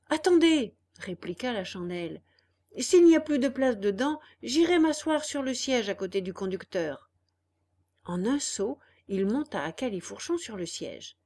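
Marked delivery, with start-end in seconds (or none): 0.1-0.7 loud, high call to wait. A narrator's reading. Candlewick calling out to the little man, loud. 2.8-7.1 louder, eager and quick; eager boy. A narrator's reading. Candlewick eagerly claiming the driver's seat, excited.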